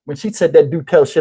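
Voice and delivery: usual speaking voice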